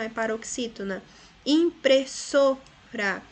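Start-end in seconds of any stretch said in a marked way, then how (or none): 1.4-3.2 impressora very slow, im-pres-SO-ra, louder; stress on penultimate syllable. Shown as a demonstration. Teacher demonstrating to pupils, impressora slowly stressed on so. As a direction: break up impressora and lean on so. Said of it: "so é a palavra".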